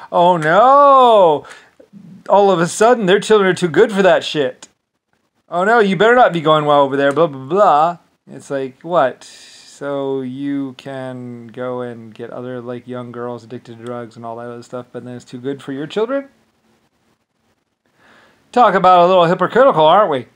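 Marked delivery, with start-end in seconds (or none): 0.1-8.0 high, loud mocking of protective parents. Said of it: "once they have children". 18.5-20.3 loud, fast sarcasm; sarcastic. Real, not acted. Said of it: "sarcastic jab".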